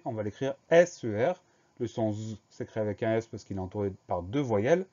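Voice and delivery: ordinary talk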